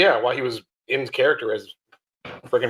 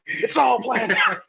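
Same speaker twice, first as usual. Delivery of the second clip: laughing shout; 11 semitones higher, slower than usual. Shown as a demonstration. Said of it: "repeated louder laughing".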